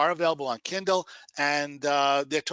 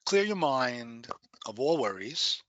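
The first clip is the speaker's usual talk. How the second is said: guided meditation. Acted. Meditation instruction.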